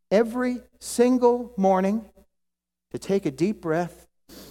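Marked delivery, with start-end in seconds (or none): none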